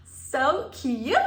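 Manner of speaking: high, loud gushing; excited squeal. Real, not acted. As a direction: squeal with delight. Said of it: "squeals over shoes".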